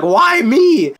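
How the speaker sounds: loud, high-pitched mock wail; imitated scream. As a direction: cry out like someone launched skyward, hammed up. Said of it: "like, he's screaming".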